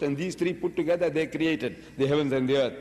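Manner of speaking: ordinary delivery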